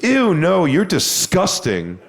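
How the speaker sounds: high recoil sliding into a slow, drawn-out "disgusting"; disgusted rejection. Retold or imitated. Woman rebuffing the comic in front of people, mock disgust. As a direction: mimic her revulsion, starting high and stretching the end.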